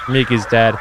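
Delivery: ordinary talk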